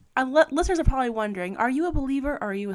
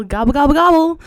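gobbling turkey, rising high and loud; playful turkey impression; turkey gobble; 6 semitones higher, 13 dB louder than usual. Turkey noise for Thanksgiving theme.

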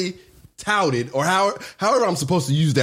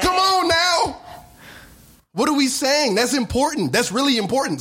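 worked up; 9 semitones higher than usual. Real, not acted. Insisting during a heated argument.

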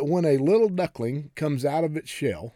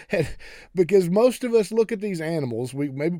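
normal talking voice

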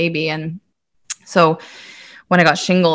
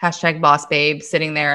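ordinary delivery

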